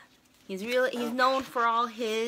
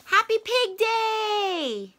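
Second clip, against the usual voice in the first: high, bright, slowed Piggie line; excited Piggie; 10 semitones higher, 4 dB louder, slower than usual. Acted. Piggie tells Gerald about her holiday, slow and high. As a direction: announce the big day, slowly and much higher.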